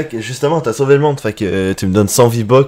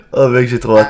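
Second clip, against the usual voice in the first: normal talking voice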